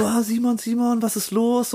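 imitating the others rushing over in concern; worried friends. Friends rushing to him in the park, high concerned voices. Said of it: "dann kamen alle so".

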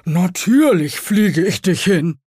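loud, slow beetle character; stag beetle voice. A narrator's reading. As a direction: agree heartily in a slow, big beetle voice. Stag beetle answers witch.

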